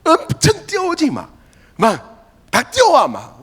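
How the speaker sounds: high, excited exclamation; excited emphasis. Real, not acted. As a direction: sell how eagerly they'd run. Disciples rushing in.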